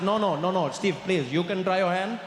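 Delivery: put-on Indian English, insistent; Indian accent. Pushing Steve to order, insistent Indian accent. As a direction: urge politely in an Indian accent. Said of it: "You got a bit of an accent".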